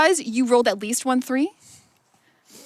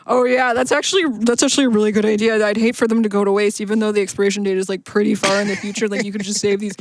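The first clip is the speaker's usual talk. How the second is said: ordinary talk, nothing marked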